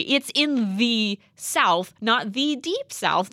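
a host correcting Southerners, slow and insistent; slow insistence. Real, not acted. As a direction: spell it out slowly for the sticklers. Arguing Texas geography point.

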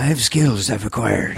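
put-on slow, menacing Irish accent; deliberate tough-guy threat, drawn out in Irish; Liam Neeson. Action hero warning an enemy, Liam Neeson voice. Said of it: "the Liam Neeson Irish".

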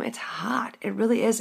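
breathy whisper. Real, not acted. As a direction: whisper it close. Whispered aside.

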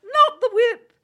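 shrill falsetto, loud, cracking with laughter; high falsetto laughing. Acted. Griffin's mock protest, falsetto and laughing. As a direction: squeal the protests through giggles. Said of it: "in a high falsetto".

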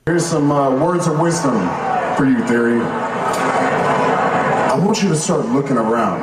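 menacing wrestler promo. Acted. Played clip, Roman's speech.

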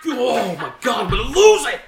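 loud, rising excited yell; excited shout. Real, not acted. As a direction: lose it over the tassels. Excited outburst about tassels.

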